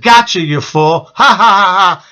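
slow mock-demon taunt into a loud spelled-out laugh; imitated evil taunt and cackle; demonic laughing voice. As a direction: gloat like a demon, ending on mocking ha-ha-ha. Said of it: "He's laughing in this demonic voice".

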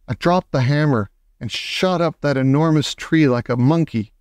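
usual speaking voice

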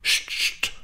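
whispered hiss of warning; shushing. A narrator's reading. Maids hush the gossiping Angrete, voiceless shushing. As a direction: shush without voice. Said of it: "machten die anderen dann".

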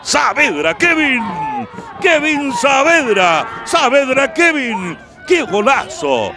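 drawn-out shouted player name; goal celebration shout. Real, not acted. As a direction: scream the scorer's name. (grito de gol)

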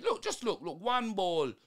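ordinary talk

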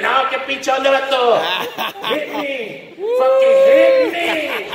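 put-on high, rising drunk taunt ending in a shout; drunk little guy picking a fight. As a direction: egg the big guy on, then yell. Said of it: "Y el pinche batillo is like".

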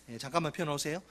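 normal talking voice

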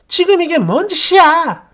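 high, loud shriek of outrage; furious shouted challenge; outraged yelling. Acted. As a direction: yell it at the top of your voice. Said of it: "고래고래 소리를 지릅니다".

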